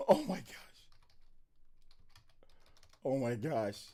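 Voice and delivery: real awe, barely voiced; awed hush. Real, not acted. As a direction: let it fall out hushed and awed. Stunned after the trailer.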